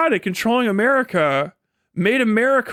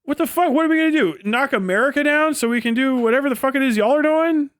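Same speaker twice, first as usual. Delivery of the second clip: much higher, disbelieving outcry; higher, pushed, baffled retort; incredulous; 5 semitones higher, faster than usual. Real, not acted. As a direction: throw it back in total disbelief. Incredulous rant reply.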